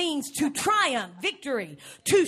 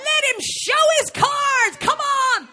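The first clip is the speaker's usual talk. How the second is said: high, loud urging; excited yelling at the TV; 9 semitones higher, 6 dB louder than usual. Retold or imitated. Woman yelling at the poker player on TV, urging him on. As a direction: shout at the screen, impatient. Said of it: "That's how she acts".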